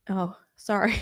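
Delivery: usual speaking voice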